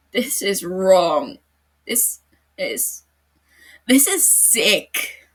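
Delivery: loud, slow, strained with disgust; distressed outburst. Acted. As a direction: force out each word, sickened. Matsuda distressed, head in hands.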